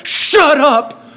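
high, drawn-out exclamation of astonishment; amazed disbelief. Retold or imitated. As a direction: exclaim it high, blown away. Amazed reaction to repeated trick.

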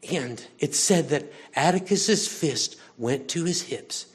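ordinary talk, nothing marked

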